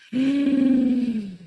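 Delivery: long, high-pitched gasp; shocked gasp. Real, not acted. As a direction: gasp aloud in real shock. Reader shocked by the messages.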